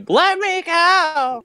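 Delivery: high, drawn-out falsetto cry for release; squealed mock plea; mock victim's scream. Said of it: "that was screaming probably".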